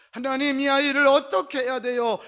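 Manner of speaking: loud, high plea to God; forceful demonstrated prayer for a child; fervent prayer. Shown as a demonstration. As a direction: cry out to God as a desperate parent. Same prayer repeated as fervent cry.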